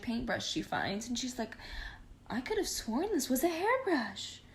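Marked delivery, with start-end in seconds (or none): 2.3-4.4 put-on much higher, puzzled girlish voice; puzzled Rapunzel. Rapunzel finds the magic paintbrush, higher and puzzled. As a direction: sound baffled and sweet.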